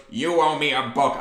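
lightly voiced cartoon villain. Librarian accusing a fifth grader, a touch of cartoon villain. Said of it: "exactly how she sounded, like ... a cartoon villain's voice".

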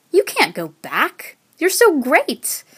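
slow, emphatic disbelief climbing high and loud; exclaimed protest. A narrator's reading. Swedish friends reacting to her departure, exclaimed protest. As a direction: protest with gushing dismay. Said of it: "they exclaimed".

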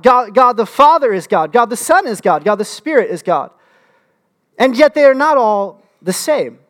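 fervent sermon build; emphatic preaching. Acted. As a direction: drive each line home to the congregation. Preaching the Trinity.

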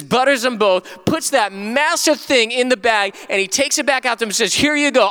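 high, mounting exasperation in the telling; dramatic storytelling. Real, not acted. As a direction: play up the absurdity, stretching key phrases. Dramatic peak of sermon anecdote.

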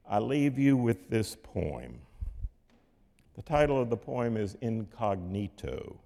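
ordinary talk, nothing marked

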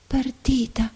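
breathless whispered repeat. A narrator's reading. The girl, leaning on the glass, whispers in shock. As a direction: let the word slip out as a whisper. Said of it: "sussurrò di nuovo".

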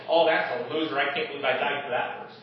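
low, soft scoffing aside; mock scornful voice. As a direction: lightly voice a sneering dismissal. God mocked as scornful.

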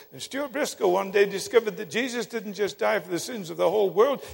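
normal talking voice

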